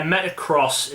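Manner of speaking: "Metacross", the X spoken as "cross"; official pronunciation. Shown as a demonstration. Host corrects the game's name, official pronunciation of "Metacross". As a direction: say "Metacross" with the X read as the word cross. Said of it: "official way of pronouncing it".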